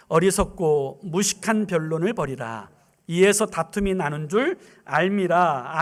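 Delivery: measured Bible reading; scripture reading. Real, not acted. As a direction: read the verse in unison. Congregation reads scripture aloud.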